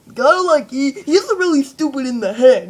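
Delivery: a hint of a dim goon; lightly dopey cartoon voice. Shown as a demonstration. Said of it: "kinda like that".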